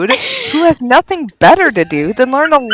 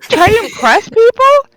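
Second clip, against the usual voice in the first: higher, louder, fast indignant rant; heated rant; 11 semitones higher, 5 dB louder than usual. Real, not acted. Friend ranting to the group about French, heated. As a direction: go off on it, really worked up.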